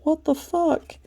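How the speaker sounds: the moon reacting in horror to a dancer below. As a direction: play the moon, appalled at what it sees. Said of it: "Here's the moon going".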